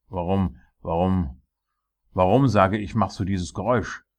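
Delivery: loud repeated why, building in force; angry demand. Acted. King confronting the dwarf over noise, angry. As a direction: demand an answer, harder each time.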